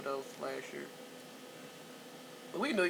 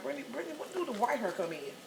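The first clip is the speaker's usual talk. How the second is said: mock high question; 7 semitones higher, faster than usual. Mother asking daughter about baby.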